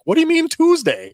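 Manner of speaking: high, loud puzzled outburst; confused commenter. Retold or imitated. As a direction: toss it up, baffled and loud. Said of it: "the folks in the comments were like".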